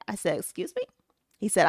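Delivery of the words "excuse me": high, hushed retold disbelief; small high incredulous question; disbelieving. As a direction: retell it softly, pitched way up in disbelief. Mother's disbelief in retold call.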